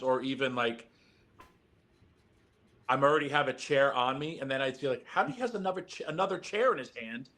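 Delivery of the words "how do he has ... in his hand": high-pitched disbelieving squeak; mock disbelief. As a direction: pretend to be baffled. Retold disbelief at a second chair.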